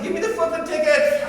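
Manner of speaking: loud, high, laughing exasperation; exasperated demand. Retold or imitated. Dad giving up on the ticket seller, loud laughing exasperation. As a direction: give in with a mock snap.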